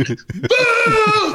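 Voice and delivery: boo him off the ice like seventeen thousand fans; huge crowd boo. Retold or imitated. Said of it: "seventeen thousand people".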